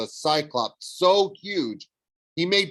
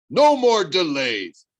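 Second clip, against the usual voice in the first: demanding monster; 5 semitones higher, 7 dB louder, slower than usual. Acted. Cyclops demanding.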